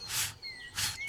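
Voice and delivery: "フッ" as pure f breath, no vowel; short voiceless f for "フッ"; English F sound. Shown as a demonstration. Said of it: "Fを発音する".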